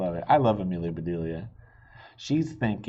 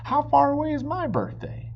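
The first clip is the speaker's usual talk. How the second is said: put-on very high, louder hopeful child; eager question; 6 dB louder than usual. Amelia Bedelia pressing her parents about her birthday.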